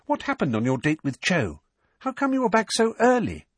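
high, lilting curiosity; bright, inquisitive questions; brightly. A narrator's reading. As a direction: ask brightly, pitch jumping up. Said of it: "said Hermione brightly".

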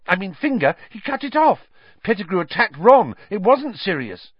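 high, urgent, tumbling outburst; bursting out. A narrator's reading. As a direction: blurt it all out, pitched high. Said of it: "They both burst into speech".

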